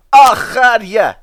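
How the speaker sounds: high, loud, slow Scottish bluster; bursting out at the van, stretched; Scottish outburst. Acted. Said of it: "Donald burst out".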